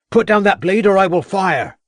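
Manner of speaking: high, loud constable's shout; shouted warning. A narrator's reading. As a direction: bark the order across the rocks. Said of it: "shouted the voice of Crabbe".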